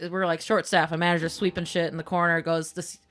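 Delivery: ordinary delivery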